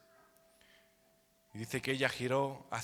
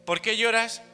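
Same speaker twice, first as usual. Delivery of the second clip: high, loud sarcastic "¿por qué lloras?"; mocking teasing question; 6 semitones higher, 6 dB louder than usual. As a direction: tease her like the angels mocking. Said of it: "con recochineo".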